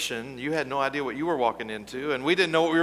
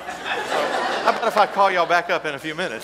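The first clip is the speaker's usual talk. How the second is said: laughing, higher and louder; 5 semitones higher, 8 dB louder than usual. Real, not acted. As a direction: speak through real laughter. Pastor laughing at the mix-up.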